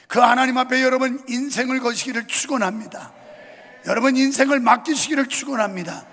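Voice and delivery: loud, rising sermon climax; fervent preaching. Acted. Pastor urges the congregation to trust God, full-force preaching.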